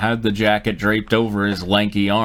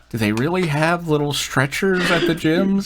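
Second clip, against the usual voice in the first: mock naive voice; 6 semitones higher than usual. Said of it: "you were like".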